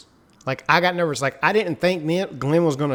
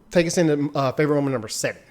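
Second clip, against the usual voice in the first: ordinary talk, nothing marked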